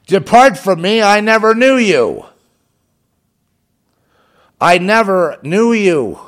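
Jesus turning the crowd away, a loud stern rebuke; Jesus rebuking. Retold or imitated. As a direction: thunder it out as a judging Lord. Jesus voiced rebuking the world.

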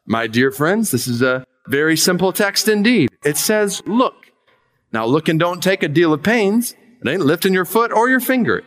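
mild hammed-up chapel preaching; fervent lay preacher. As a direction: plead the simple text like an untrained preacher. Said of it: "The preacher began thus".

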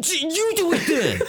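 high, laughing mock outburst; indignant. Host voices Jesus refusing the cross, mock indignant. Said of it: "he got real indignant".

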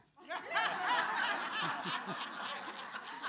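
high, loud burst of laughter; big laugh. Real, not acted. Preacher laughing at her own punchline, loud and high. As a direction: let the laugh ring out.

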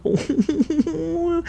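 high, hammed-up weepy "oh"; mock tearful. Viewer reacting to the cheeseburger callback, mock tearful. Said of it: "I was tearing up the whole fucking movie".

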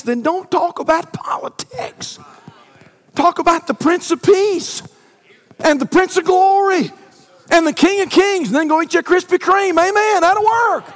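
high, loud preaching climb; fervent preaching. Acted. Pastor telling the church to praise Christ, fervent preaching. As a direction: lift the room to praise. Preacher rising to full force.

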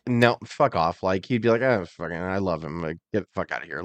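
low, soft grumbling dismissal; muttered brush-off. Retold or imitated. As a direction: grumble it under your breath. Imitating Hogan brushing fan off.

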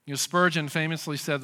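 ordinary talk, nothing marked

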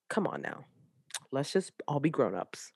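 dropped to a soft aside; quiet aside. Real, not acted. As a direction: say it softly, almost to yourself.

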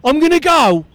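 chirpy Aussie bloke; aussie tradesman. Acted. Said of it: "it's Brian".

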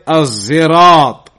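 "Az-Zirat" with a buzzed z for the s; Az-Zirat with Zai. Shown as a demonstration. Teacher shows the third pronunciation, "Az-Zirat" with voiced Zai. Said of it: "and with a Zai".

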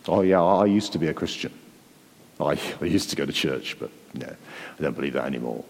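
low, fading, offhand voice of someone who drifted away; dismissive ex-believer. Retold or imitated. A drifted churchgoer brushing off his old faith, low and offhand. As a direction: shrug it off like it never mattered. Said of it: "the most dangerous expression you'll hear anyone say".

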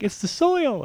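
lightly high wife's reply. Retold or imitated. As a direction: lift it into her voice. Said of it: "she's like".

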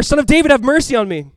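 loud, high impassioned plea. A narrator's reading. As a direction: call out over the crowd. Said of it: "he cried out all the more".

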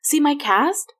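put-on high, eager child's question; excited. A narrator's reading. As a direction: proudly show it off. Excited boy showing cast.

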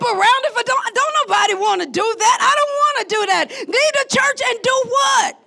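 high, impassioned pulpit shouting; incredulous, fervent preacher at peak intensity; full-force preaching. Real, not acted. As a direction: cry it out to the congregation.

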